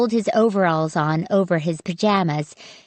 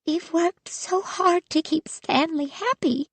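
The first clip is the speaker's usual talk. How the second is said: high, much softer sad grandmother voice; sadly; 8 semitones higher, 5 dB quieter than usual. A narrator's reading. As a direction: sigh it out, worn down and sorry. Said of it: "Grandma Miriam said sadly".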